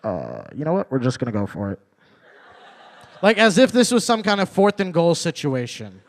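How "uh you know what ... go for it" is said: casual captain. Retold or imitated. Captain tells passengers they'll risk it, low casual. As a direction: shrug it off like a relaxed pilot. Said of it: "He's like".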